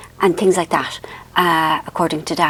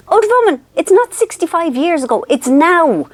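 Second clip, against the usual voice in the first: mocking; 9 semitones higher than usual. Daughter teasing her mother about the shopping list, high mock scolding. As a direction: hoot at her like a cheeky daughter.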